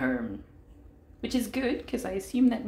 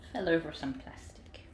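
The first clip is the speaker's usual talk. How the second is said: whispering; 7 semitones lower, 6 dB quieter than usual. Real, not acted. Whispered aside.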